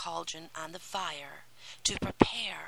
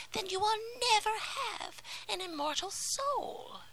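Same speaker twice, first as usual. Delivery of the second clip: high, whispery menacing witch; a crooning sea witch, sly and cruel. Acted. Sea witch warns the mermaid.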